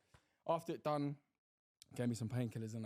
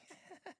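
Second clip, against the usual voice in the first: high soft mocking giggle; high soft imitated giggle; 13 dB quieter than usual. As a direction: giggle like the amused nurses. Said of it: "giggling and that".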